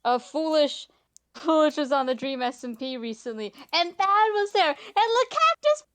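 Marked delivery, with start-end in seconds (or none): 3.7-5.8 high-pitched, excited shouting; real squealing excitement, loud and high; loud excited outburst. Real, not acted. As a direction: get so excited you nearly overload the mic. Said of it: "you talked so loud you cut out".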